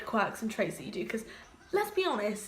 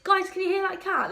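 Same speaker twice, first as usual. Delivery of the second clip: high, louder mock-puzzled question; feigned innocence; 9 semitones higher, 5 dB louder than usual. Meower fooling her workmates, feigned innocence. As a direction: ask it high, pretending you have no idea.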